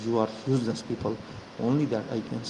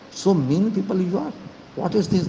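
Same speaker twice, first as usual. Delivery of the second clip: indignant outburst; 7 semitones higher than usual. Real, not acted. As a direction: let the outrage burst out. Furious rant at opponents.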